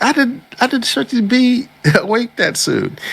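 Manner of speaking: squeaky embarrassed heroine, half laughing. Retold or imitated. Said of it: "She's like".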